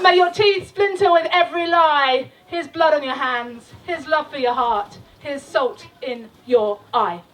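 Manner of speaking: declaimed curse lines, fading; spoken-word ending; closing curse. Acted. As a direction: land the final curses.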